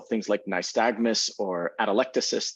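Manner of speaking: normal talking voice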